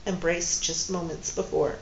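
plain reading voice

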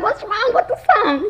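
very high, amused retold question; high-pitched mock disbelief; puzzled mom. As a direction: replay the bafflement, nearly laughing. Mother questions crying son again.

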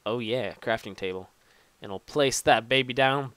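ordinary talk

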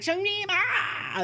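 hammed-up imitation of coworkers calling out; mock coworker yell. Gamer imitating coworkers pointing and yelling, high and hammed-up. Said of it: "They're like".

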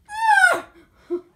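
squealing laugh, loud; high shrieking laugh. Real, not acted. Laughing shriek as the vlogger slips off.

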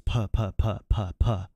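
hard puffs of breath on each puh; popped plosives. Shown as a demonstration. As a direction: spit out punchy plosive puhs. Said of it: "my plosives tend to be a little bit hot".

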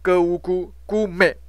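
歌乌姑姑美 slowly, with a hard "g" on 歌; teacher sounding out 歌乌姑姑美 piece by piece; sounded-out syllables. Shown as a demonstration. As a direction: spell out 歌乌姑 then blend into 姑美. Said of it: "给他发个的音哈".

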